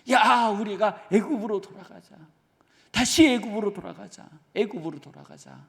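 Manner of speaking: rally the crowd to turn back to Egypt; mock rabble-rousing complaint; complaining crowd. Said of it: "하고 불평을 해요".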